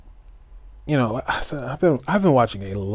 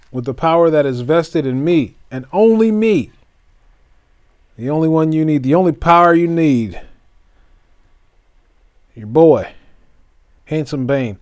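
louder, grand ring-announcer build; hammed-up ceremony announcement; mock induction announcer; 4 semitones higher, 5 dB louder than usual. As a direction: proclaim it like a pompous emcee. Mock Hall of Fame induction.

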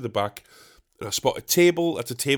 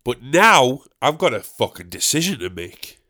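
ordinary talk, nothing marked

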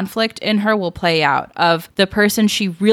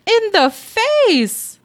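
shocked echo; much slower than usual. Real, not acted. Echoing shocked punch news.

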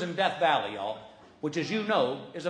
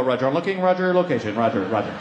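low, clipped radio-style pilot talk; a low mock military radio voice; 9 semitones lower, faster than usual. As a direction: play the serious pilot, low. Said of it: "if I don't talk like a pilot".

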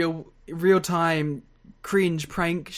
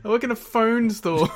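higher, laughing through the clerk's reply; 7 semitones higher than usual. Retold or imitated. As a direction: crack up while playing the clerk. Voicing the store clerk, laughing.

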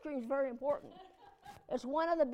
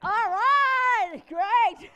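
big high-pitched whoop of approval; loud, drawn-out celebration; loud cheer; 6 semitones higher, 10 dB louder, much slower than usual. Real, not acted. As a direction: cheer it out loudly, much higher. Mock cheer at his phone.

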